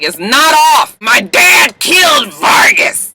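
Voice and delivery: high, loud, emphatic protest; insistent mock shout. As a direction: act out an indignant shout, punching each word. Retold Olivia insisting in argument.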